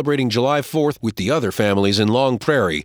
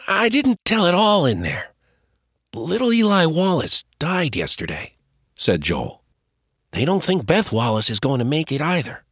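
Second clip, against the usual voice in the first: put-on higher boy, grave; boy's voice; 5 semitones higher than usual. Joel tells his father a neighbor child died, higher boy voice.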